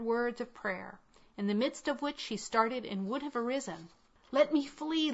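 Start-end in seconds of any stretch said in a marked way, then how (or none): 4.3-5.1 first plea pitched high, stress on let; panicked opening cry, "let" raised; terrified plea to flee; frightened cry. A narrator's reading. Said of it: "she cried".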